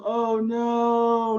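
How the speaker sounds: panicked oh no. Acted. Raven starts falling.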